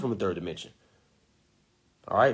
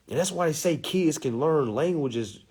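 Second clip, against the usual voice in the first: ordinary talk, nothing marked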